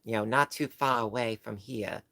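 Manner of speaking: amateur Boston accent with dropped r's. Shown as a demonstration. Streamer showing what people think Boston sounds like, in a mock Boston accent. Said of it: "when you think Boston".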